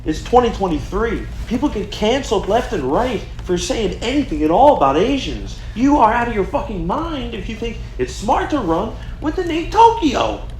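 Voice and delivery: rising, frantic pleading; exasperated pleading. Pretend. Staffer pleading with Joe, escalating.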